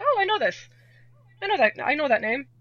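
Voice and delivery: high-pitched re-enacted excitement; delighted recognition. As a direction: pipe up as if spotting an old favourite. Acting out recognising a familiar game.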